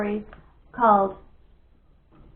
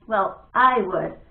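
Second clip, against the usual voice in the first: stubborn; 3 semitones lower, 5 dB louder, much slower than usual. Acted. Bat stubborn.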